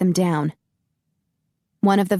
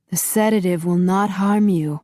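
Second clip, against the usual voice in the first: muffled masked villain. A narrator's reading. Master Han voice.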